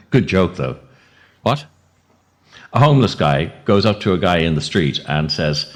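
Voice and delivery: usual speaking voice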